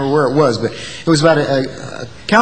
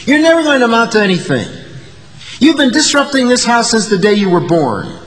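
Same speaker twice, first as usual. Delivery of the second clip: imitating a berating father, raised pitch; scolding father; 7 semitones higher than usual. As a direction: lay into the child like a fed-up parent. Father's insults quoted from her childhood.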